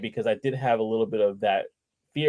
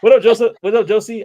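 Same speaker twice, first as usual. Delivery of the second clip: loud, high-pitched shout-out; calling out a greeting; 11 semitones higher, 10 dB louder than usual. Real, not acted. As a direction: hail a viewer who just joined, loud and high.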